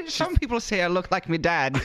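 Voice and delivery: high, hammed-up Irish accent; Irish twang. Shown as a demonstration. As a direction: put on a comic Irish twang. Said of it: "practicing her own little Irish twang".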